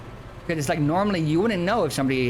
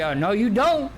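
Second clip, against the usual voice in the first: higher and louder, teasing dismissal; mock grown-up brushing off a kid; knowing adult retort; 7 semitones higher, 4 dB louder than usual. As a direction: play the adult who knows better. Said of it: "when adults tell you".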